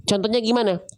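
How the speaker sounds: normal talking voice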